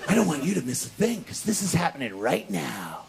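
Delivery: low, self-important taunt; low-voiced gloating; smug relish. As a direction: relish every word, deep and pleased. Relishing the acted put-down moment.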